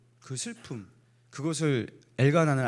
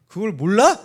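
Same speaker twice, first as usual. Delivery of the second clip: mock indignant; 6 semitones higher, 10 dB louder than usual. Preacher mock dialogue with congregation.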